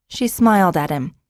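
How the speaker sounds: usual speaking voice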